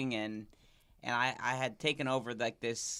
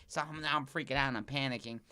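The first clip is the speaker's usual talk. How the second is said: normal talking voice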